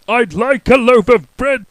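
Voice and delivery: loud high boom. Acted. Mister Noisy ordering bread from the baker, booming. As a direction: boom the order across the shop. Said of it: "boomed Mister Noisy".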